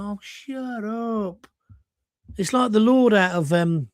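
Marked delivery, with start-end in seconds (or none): 0.0-1.5 long, slow, drawn-out brush-off; weary dismissal. Acted. Host fed up with actor talking politics, drawn-out dismissal. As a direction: stretch it out, fed up.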